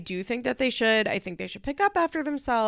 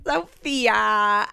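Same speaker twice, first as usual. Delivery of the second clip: mock whiny mom; 4 dB louder than usual. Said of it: "Farrah goes".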